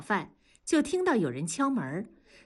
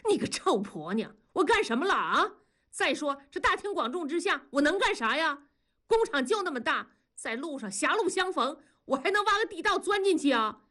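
high-pitched, indignant outcry without much volume; yelled protest; 8 semitones higher than usual. A narrator's reading. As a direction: protest innocence in a rising voice. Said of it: "喊道". (高声叫屈)

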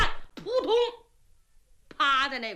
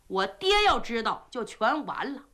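plain reading voice

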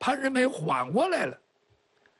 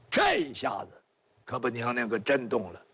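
ordinary delivery, nothing marked

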